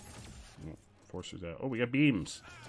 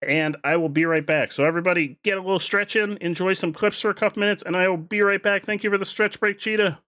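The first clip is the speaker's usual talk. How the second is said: excited; 8 semitones higher, 8 dB louder than usual. Real, not acted. Hyped streamer announces break.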